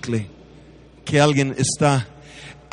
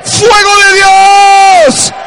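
preaching at full force; 9 dB louder than usual. Acted. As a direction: proclaim it over the nation. Preacher's closing declaration. (predicador que declara a gritos sobre México)

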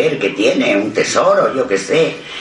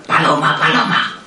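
high-pitched urgent-sounding name call; 6 semitones higher than usual. Retold or imitated. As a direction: call someone awake without raising the volume. Retells being called at night. (llamando en voz aguda)

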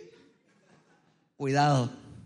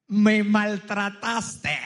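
very drawn-out mock accusation, every syllable stretched; hammed-up cry of the wronged woman; accusing wail; 4 dB louder, much slower than usual. Comedian voices woman after man touches her, hammed-up drawn-out accusation. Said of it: "te va a decir una frase de destrucción masiva".

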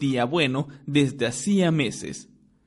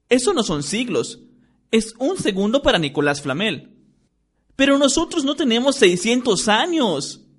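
raised schoolkid voice, bickering; lightly voiced children, higher pitch; young characters' voices; 9 semitones higher, 4 dB louder than usual. A narrator's reading. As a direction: friends arguing mildly about exams. Hermione and Ron voiced.

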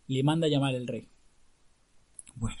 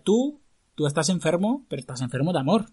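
clown doctor voice; 3 semitones higher, 7 dB louder than usual. Acted. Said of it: "Aunque hablaba con esta voz".

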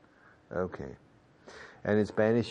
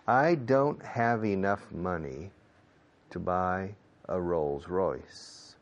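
slow model sentence; much slower than usual. Shown as a demonstration. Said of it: "teacher models sentence for class".

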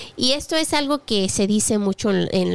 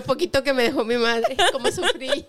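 hammed-up sob story, laughing; fake-cry over tiny inheritance; mock crying lament; 3 semitones higher than usual.

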